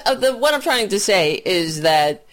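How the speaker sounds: ordinary talk, nothing marked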